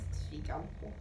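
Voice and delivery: normal talking voice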